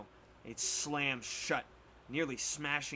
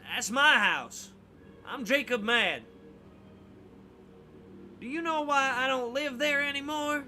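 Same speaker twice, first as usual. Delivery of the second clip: put-on gruff old man; 11 semitones higher, 6 dB louder than usual. Old man Jacob Mad speaks.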